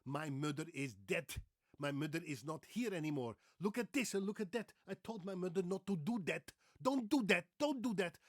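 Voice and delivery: put-on heavy Dutch accent, building to loud and high; Dutchman's English. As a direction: play a Dutchman, th hardened to d. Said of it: "That's how a Dutchman would pronounce it".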